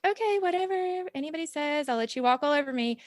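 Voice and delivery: high, pliant pushover voice; meek former self. Retold or imitated. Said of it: "before I would just be like".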